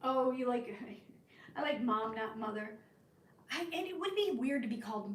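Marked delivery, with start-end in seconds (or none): none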